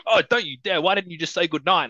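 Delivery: high-pitched, put-on offended protest; playful mimicry of a touchy scolding; mock indignation. Mimics the character's touchy reaction.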